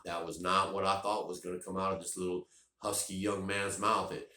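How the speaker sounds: normal talking voice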